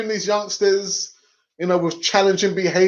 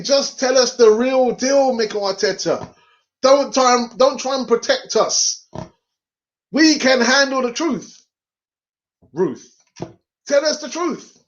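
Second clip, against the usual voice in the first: higher-pitched, mock-impassioned appeal; exasperated plea; 7 semitones higher than usual. Fan imagining demanding truth from manager, exasperated plea. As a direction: plead with the manager for honesty.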